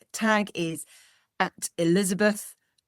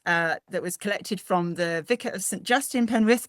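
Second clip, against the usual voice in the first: normal talking voice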